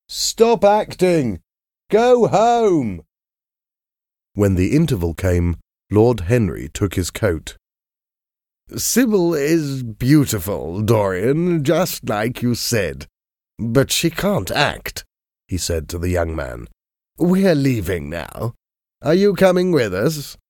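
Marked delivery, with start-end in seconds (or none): 0.1-3.0 high, slow, hammed-up crowd yell; audience shouting. A narrator's reading. Theatre audience jeering at Sybil, slow high mock shout. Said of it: "the audience was shouting to Sybil". 8.8-20.4 a hint of a dry aristocrat, unhurried; Lord Henry. A narrator's reading.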